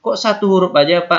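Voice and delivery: a pushy pupil insisting on an answer; insistent student. Pretend. Teacher voices insistent student.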